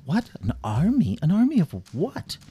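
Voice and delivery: incredulous questions climbing to a high last word; alarmed disbelief. Acted. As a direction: demand answers in startled disbelief. King stunned by the news.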